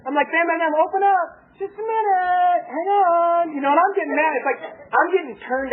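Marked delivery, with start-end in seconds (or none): 0.3-1.3 pitched-up demand with spoken bangs; acted door pounding. 1.6-3.4 high, drawn-out mock child whine; stalling kids.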